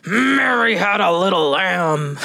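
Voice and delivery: slow, growled-out pretend anger; hammed-up angry recital; mock angry. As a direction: play at being angry, dragging the words. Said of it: "try it like you're angry".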